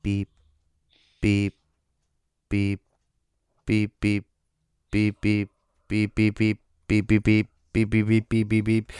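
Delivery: steady key fob beeps; beep-beep like a car remote; imitated beeping. Shown as a demonstration. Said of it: "do the, like".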